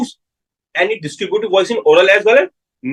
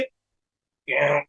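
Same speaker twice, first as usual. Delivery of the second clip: softer, nasal twang, as if through the nose; nasal voice demo; 4 semitones lower, 6 dB quieter, much faster than usual. Shown as a demonstration. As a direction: show how a hypernasal patient sounds. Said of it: "it will sound like कि आवाज नाक से आ रही".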